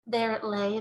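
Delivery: usual speaking voice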